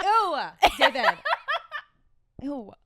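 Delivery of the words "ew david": a light, laughing "Ew, David" as Alexis; a playful hint of Alexis Rose; Alexis Rose impression. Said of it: "Alexis Rose from Schitt's Creek".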